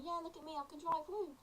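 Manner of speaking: mock showing off. New driver taking friends out, playfully showing off. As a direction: brag lightly in a high, teasing voice.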